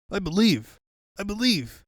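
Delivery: high panicked repeated plea; frightened pleading. Acted. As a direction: beg in fear of the ghost. Scared Robotnik pleading.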